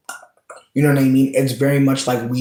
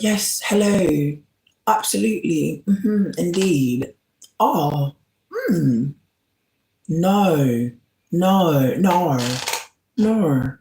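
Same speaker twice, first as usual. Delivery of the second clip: put-on higher, slow, prim British accent; pretend British; 4 semitones higher, much slower than usual. Showing how she talks in New Orleans, in a prim British accent. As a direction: play a prim British phone voice. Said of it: "when I pretend to be British".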